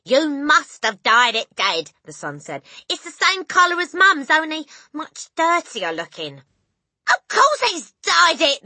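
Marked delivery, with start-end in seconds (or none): 0.0-1.9 high, loud insistent son; loud accusation. A narrator's reading. Son to dad, loud accusing voice. 2.9-6.4 high, loud son's voice; loud taunting remark. A narrator's reading. Son comparing dad's hair to mum's, loud voice. Son joins noisy family row. 7.1-8.6 high, loud outcry; exasperated cry. A narrator's reading. Mother about husband, exasperated cry. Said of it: "the mother cried".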